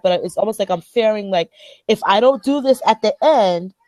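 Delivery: normal talking voice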